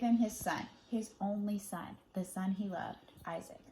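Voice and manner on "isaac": ordinary delivery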